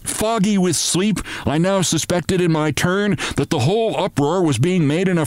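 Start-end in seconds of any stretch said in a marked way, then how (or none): none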